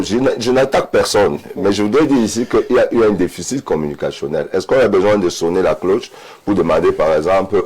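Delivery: ordinary talk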